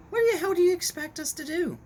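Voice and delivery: sudden very high, loud frustrated cry; exasperated outburst. Real, not acted. Ranting about the Gillette ad, exasperated high outburst. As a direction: throw up your hands in disbelief, voice jumping up.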